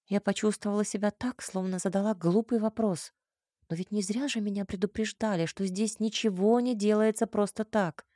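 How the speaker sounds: plain reading voice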